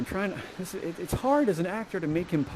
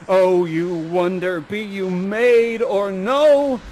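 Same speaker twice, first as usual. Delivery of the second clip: very slow, swelling wooing; Ferdinand; 6 dB louder, much slower than usual. Acted. Ferdinand wooing the beautiful young lady, slow loud theatrical wonder. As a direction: draw each word out, rising in volume. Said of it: "and he goes".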